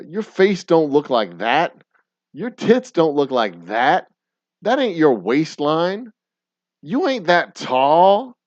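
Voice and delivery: loud, high, sassy stand-up rant; ranting comedy bit. Retold or imitated. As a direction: belt out the roast like a comic on stage. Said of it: "That is a bit that has stuck with me forever".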